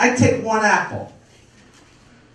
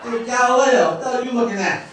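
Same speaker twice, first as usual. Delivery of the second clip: Brooklyn uncle; 6 semitones higher than usual. Retold or imitated. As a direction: call him out like a tough Brooklyn uncle. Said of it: "my uncle would be like".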